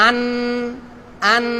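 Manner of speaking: আন held on an n with tongue tip; wrong hard n. Shown as a demonstration. Teacher shows the mistake, held n in আন. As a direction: sustain the n in আন instead of an ng. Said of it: "এরকম হবে না".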